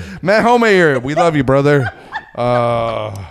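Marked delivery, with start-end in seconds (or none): 0.2-1.9 high, loud shout-out to a buddy; loud, high dedication, easing off at the end; hearty shout-out. Real, not acted. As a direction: call it out proudly to a friend. Shout-out to a friend.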